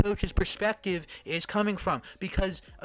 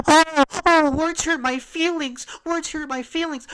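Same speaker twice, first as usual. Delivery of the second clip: high, loud, whiny mock of someone crying over words; mocking whine; 12 semitones higher, 6 dB louder than usual. Mocking the critic as a crybaby, high whiny mock voice. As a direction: sneer it out like a crybaby.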